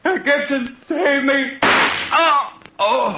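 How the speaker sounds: high panicked cries; terrified screams. Acted. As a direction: shriek in terror. Victim screams before the shot.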